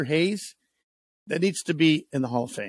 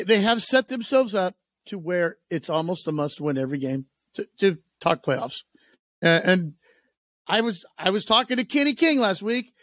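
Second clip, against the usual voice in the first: ordinary delivery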